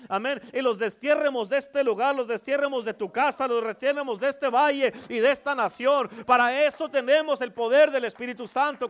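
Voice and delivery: loud, high, driving preacher voice; fiery preaching. Acted. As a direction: preach it hard to the congregation. Preaching at full force.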